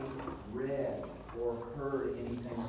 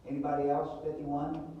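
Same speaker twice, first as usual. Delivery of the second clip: louder and higher, calling out; to someone hard of hearing; 3 semitones higher, 5 dB louder than usual. Real, not acted. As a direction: project to a juror who can't hear. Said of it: "Talk louder, please".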